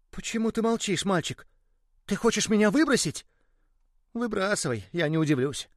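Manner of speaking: high, hurt toy voice, then resigned; complain, then give up; hurt little ball. Acted. The ball tells the boy about the truck and expects to be thrown away, high and hurt.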